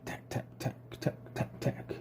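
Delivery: soft unvoiced tapping tack tack tack; breathy knocking woodpecker taps; drumming tack sounds. A narrator's reading. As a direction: tap out a woodpecker's drumming in a whisper. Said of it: "He drummed on the oak tree".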